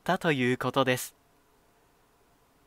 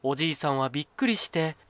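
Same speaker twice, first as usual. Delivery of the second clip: plain reading voice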